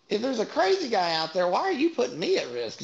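high-pitched exasperated questioning; mock-indignant plea, pitched well up; retold protest.

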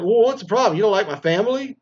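high, loud mock wife, offended. Wife accusing her husband of hating her family, in a high loud mock voice.

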